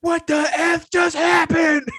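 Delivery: very high, loud, drawn-out bellow; imitated shocked yell. Bar fan looking up at first and goal, imitated shocked yell. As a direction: mimic a fan exploding in disbelief.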